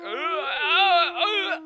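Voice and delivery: loud high drawn-out moans; hammed-up cries of distress; mock scared wailing. [name] reacts to spooky noises.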